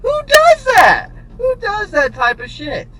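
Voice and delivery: ranting about the boss, high and outraged; incredulous outrage. Real, not acted. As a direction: cry out who could do that. Outraged rhetorical question.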